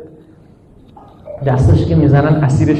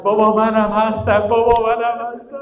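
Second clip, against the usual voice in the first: higher and higher, ending in a thin high wail; child's plaintive cry; 8 semitones higher than usual. Acted. Baby in the cradle calling his father, a high wail. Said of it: "ناله کرد".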